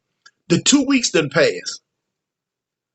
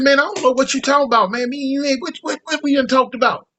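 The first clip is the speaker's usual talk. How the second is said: high, quick mock confusion; feigned innocence; 7 semitones higher than usual. Denying the deal to the captain, mock innocence. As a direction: act like you know nothing.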